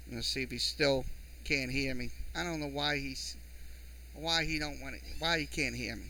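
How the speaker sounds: very soft, puzzled grumble; muttered aside. Real, not acted. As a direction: wonder aloud to yourself, barely audible. Puzzled aside about the listener.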